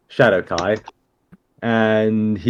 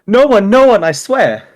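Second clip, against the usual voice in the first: nervous denial; 10 semitones higher, 6 dB louder than usual. Acted. Rogue denies breaking in.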